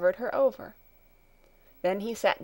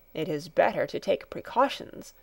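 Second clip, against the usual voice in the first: usual speaking voice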